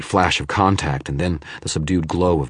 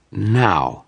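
sneering; 5 semitones higher, 4 dB louder than usual. Acted. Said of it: "a sneer to its voice".

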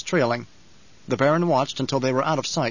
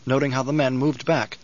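plain reading voice

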